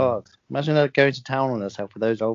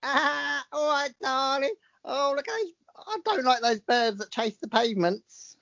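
higher, breezy London lass impression; London accent; 11 semitones higher, 3 dB quieter than usual. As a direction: play a chatty Londoner, pitched up. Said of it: "Last one".

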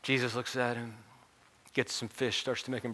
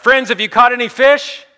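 high, very loud distant hail; yelling out; 21 dB louder than usual. Acted. Stranger on the beach to the fishermen, yelling out. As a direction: holler it across the water. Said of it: "the man yells out".

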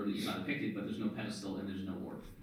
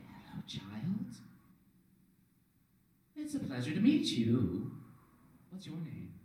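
higher, hushed otherworldly voice; whispering mysterious voice; 5 semitones higher than usual. Acted. Orb speaking to the one who grabbed it, eerie hushed voice. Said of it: "you hear a voice whisper".

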